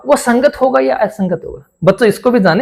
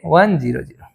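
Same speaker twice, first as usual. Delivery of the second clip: class answering; 5 semitones lower, 4 dB quieter than usual. Pretend. Teacher voices class answer.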